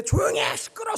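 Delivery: sharp high shout of silence; father shouting. Retold or imitated. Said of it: "소리 지르는데". (아이들을 조용히 시키려 높게 외치는 아버지)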